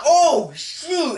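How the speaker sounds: high-pitched, drawn-out "oh" of shock; startled reaction. Retold or imitated. Fan retelling the punch scene, mock shocked exclamation. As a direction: react like a stunned viewer.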